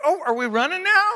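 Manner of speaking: high, fast, loud are we running now; startled and scrambling to keep up; a high, quick mock alarm; surprised. Surprised wife runs.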